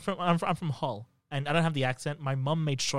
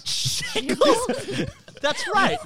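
shrieking with laughter; high laughing squeal; 4 dB louder, much slower than usual. Real, not acted. Joking about being a pirate, laughing high.

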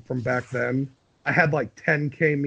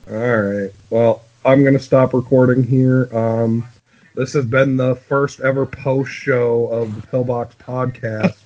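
louder, slower announcer sign-off; podcast outro; 2 semitones lower, 8 dB louder, slower than usual. Acted. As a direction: close the show like a host.

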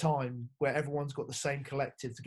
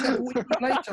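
bright, higher-pitched chummy coach voice; cheery coach; 12 semitones higher, 10 dB louder, much faster than usual. Retold or imitated. Coach quizzing the player at breakfast, higher and chirpy. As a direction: play the oblivious coach asking keenly. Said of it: "He goes".

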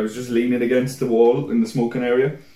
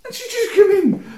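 laughing. Real, not acted.

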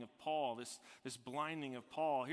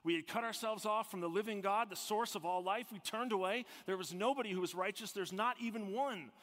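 raised, higher, urgent preacher voice; full-force preaching; 8 semitones higher, 6 dB louder than usual. Real, not acted. Preacher proclaiming that nobody was righteous, full-force preaching. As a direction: proclaim it loudly, phrase upon phrase.

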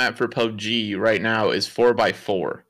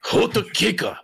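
mock Japanese; 10 semitones higher than usual. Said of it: "how do you say hotcakes in Japanese?".

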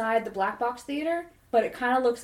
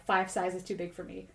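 ordinary talk, nothing marked

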